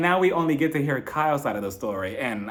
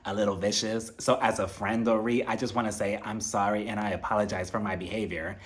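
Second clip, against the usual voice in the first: softer, lower mock-contrite apology; Erica's fake-humble apology, voice dropped; 5 semitones lower, 5 dB quieter than usual. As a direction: play her sorry act quiet and low, lightly mocking. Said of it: "She was like".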